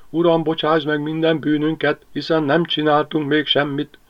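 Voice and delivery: Ágnes praying in her head, mildly frightened; stammering prayer. Acted. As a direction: pray in a frightened rush. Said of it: "dadogták Ágnes gondolatai".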